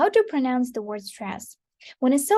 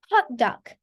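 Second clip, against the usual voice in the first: Hot dog as one compound, HOT-dog; stress on HOT. Shown as a demonstration. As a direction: hit Hot, let dog drop away. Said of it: "we pronounce it quite differently".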